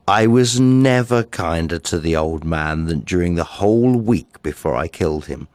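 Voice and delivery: plain reading voice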